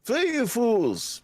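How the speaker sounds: high, slow wizard cry; Gandalf impression. Shown as a demonstration. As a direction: bellow it like an old wizard. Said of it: "Gandalf is like".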